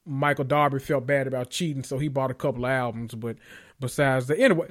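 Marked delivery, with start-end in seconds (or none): none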